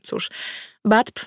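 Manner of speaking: ordinary delivery, nothing marked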